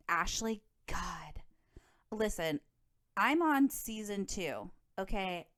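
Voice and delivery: ordinary talk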